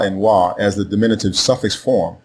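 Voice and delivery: normal talking voice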